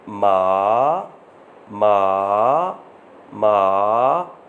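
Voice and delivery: माँ very slow, dipping down then rising; dipping third tone. Shown as a demonstration. Teacher to students, demonstrating the third tone: slow dipping माँ. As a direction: drag माँ down and back up slowly. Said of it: "falling and rising tone के साथ".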